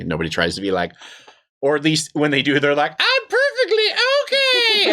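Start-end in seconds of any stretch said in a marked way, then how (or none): 3.0-4.9 very high, strained mock squeal; obviously not okay. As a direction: imitate someone badly pretending to be fine. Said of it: "they make it very obvious".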